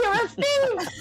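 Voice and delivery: high, fussy mother's voice; fretting mom. Acted. Mother grilling son about helping people, high fretting. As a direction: nag him like a worried mother.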